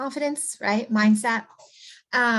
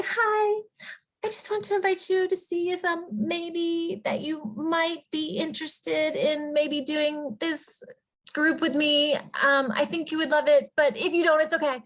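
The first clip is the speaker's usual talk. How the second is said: timid, apologetic invite; 5 semitones higher than usual. Acted. Coach demonstrates a poor sales invite, timid and apologetic. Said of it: "How many of us do we speak like that".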